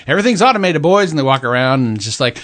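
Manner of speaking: imitating a Canadian hoser; hammed-up Canadian brother voice; McKenzie brothers impression. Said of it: "I can't do my impressions today".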